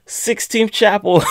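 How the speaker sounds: mock-solemn catchphrase; mock dramatic. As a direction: say it like a grand reveal while handing over. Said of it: "I'm just like".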